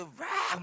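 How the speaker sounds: imitated wild "waak" roar; sudden outburst yell. Testifier imitating his sudden cursing at the kids, wild yell. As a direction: burst out with a mock possessed squawk. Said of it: "그런 식으로 애들한테 쌍욕을 하다가".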